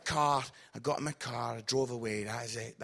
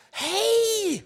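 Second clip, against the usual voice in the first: fake cheerful hey; 12 semitones higher, 5 dB louder than usual. Pretending to recognise her.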